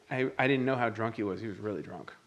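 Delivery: ordinary delivery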